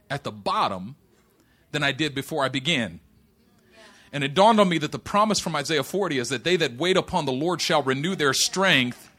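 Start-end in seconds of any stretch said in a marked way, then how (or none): 4.1-9.1 scripture proclamation. Acted. Pastor proclaiming Isaiah forty to the church, building.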